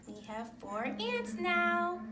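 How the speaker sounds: normal talking voice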